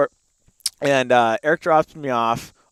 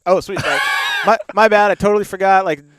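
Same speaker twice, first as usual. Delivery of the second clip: breezy fake innocence; 4 semitones higher, 5 dB louder, faster than usual. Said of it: "I'm like".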